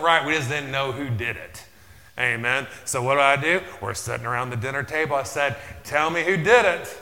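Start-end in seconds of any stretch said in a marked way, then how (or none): 5.8-7.0 loud, stern fatherly demand, higher. Retold or imitated. Father at dinner demanding which child did it, loud and stern. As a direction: bark it at the kids around the table.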